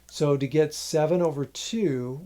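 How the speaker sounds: ordinary delivery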